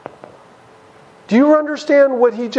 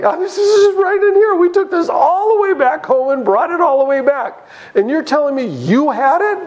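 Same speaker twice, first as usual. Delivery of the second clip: higher, urgent disbelief; agitated brothers protesting; 7 semitones higher than usual. Acted. Brothers protest to Joseph's servant, voice up in alarm. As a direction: pitch it up like a confused, worried brother.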